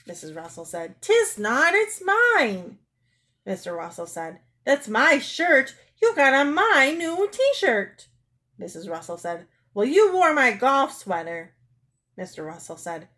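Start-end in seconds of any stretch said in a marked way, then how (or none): 1.0-2.8 put-on high, loud childish retort; shrill squabbling denial; louder contradicting. Wife throws same words back. 4.6-8.1 high, loud, slow accusation; outraged, emphatic protest; quarrelsome. Acted. Acted quarrel over a shirt. 9.7-11.5 louder, higher comeback; raised-voice squabbling; indignant retort. Acted. Acted retort in quarrel.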